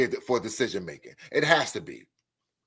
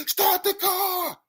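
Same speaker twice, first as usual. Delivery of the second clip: very high, slow mock scream; imitated screaming; slower than usual. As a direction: cut in with a stretched, desperate cry at moderate volume. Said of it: "came running out the woods screaming".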